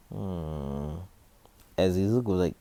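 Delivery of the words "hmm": long, softer pondering hmm; thinking hum. Acted. Izuku still choosing a name, soft thinking hum. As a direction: hum quietly while mulling it over. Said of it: "Izuku thinks about it".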